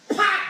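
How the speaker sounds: high, sudden karate yelp; imitated strike cry. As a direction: punch out a mock blow.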